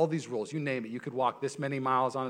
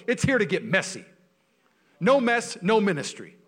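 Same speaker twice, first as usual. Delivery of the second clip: higher, louder pulpit delivery, driving each line home; full-force preaching; 10 semitones higher, 9 dB louder than usual. Real, not acted. Pastor urging his church to get messy, full-force preaching. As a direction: preach it hard to the room.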